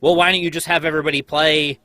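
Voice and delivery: usual speaking voice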